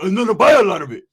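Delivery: loud imitated Uso accent. Uso customer promising big orders, loud Uso accent. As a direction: boom it out in a mock Uso accent. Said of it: "he had that little accent".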